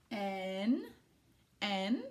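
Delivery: "N" said as a drawn-out syllable, en; sounding out. Shown as a demonstration. Teacher sounding out first two sounds, drawn out. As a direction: hold the syllable "N", then repeat it. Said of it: "sound out the first two sounds".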